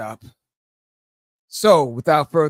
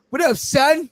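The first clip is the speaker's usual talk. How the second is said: high-pitched New York slang greeting; New York talk; 11 semitones higher, 4 dB louder than usual. Acted. Host showing off to his co-host, New York talk. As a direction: toss it out like a New Yorker. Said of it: "That's some New York talk".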